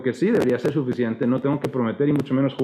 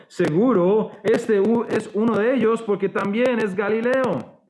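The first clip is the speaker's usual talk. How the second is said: insistent accuser; 7 semitones higher, slower than usual. Acted. Bystander insists Peter is Galilean.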